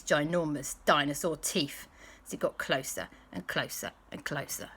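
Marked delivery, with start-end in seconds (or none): 2.3-4.7 hushed suspense. A narrator's reading. Suspense as dinosaur creeps nearer.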